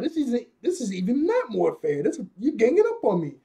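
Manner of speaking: high, swooping hero whine; mock indignant protest. Luffy facing a ton of attackers, mock indignant. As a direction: complain high in a playful character voice.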